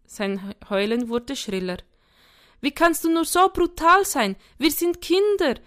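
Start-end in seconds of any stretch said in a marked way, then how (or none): 2.6-5.6 put-on high, loud indignant child; shrilly raised, affronted kid voice; outraged. A narrator's reading. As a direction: protest like an appalled little boy. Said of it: "empörte sich sein Bruder".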